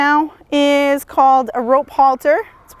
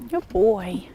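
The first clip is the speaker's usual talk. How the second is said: soft praise; 4 semitones lower, 6 dB quieter than usual. Real, not acted. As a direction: soothe the horse quietly. Praising horse during lunging.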